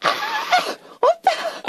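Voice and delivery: very high mock squeal; imitated fan scream. Imitating a fan on the street, high-pitched scream. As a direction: squeal like a fan spotting an idol. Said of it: "hear screaming".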